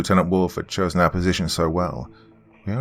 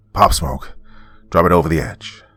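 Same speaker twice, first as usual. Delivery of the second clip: firm, moderately raised command; yelled order; 4 dB louder than usual. A narrator's reading. Team leader ordering two seals in battle, moderate yell. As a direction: call orders to the squad. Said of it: "while yelling".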